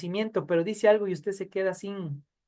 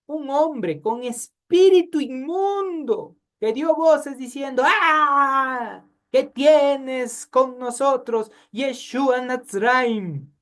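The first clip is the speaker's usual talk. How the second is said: high, slow, loud Bible reading; dramatic scripture reading; 5 semitones higher, 4 dB louder, slower than usual. Acted. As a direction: read the possessed man's cry slowly and gravely. Reads scripture. (lectura bíblica dramática, lenta y en voz alta)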